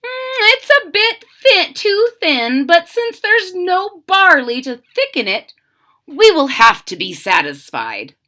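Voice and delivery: high, loud soldier musing. Acted. First soldier stirring the soup, high and loud. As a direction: raise the pitch and lament.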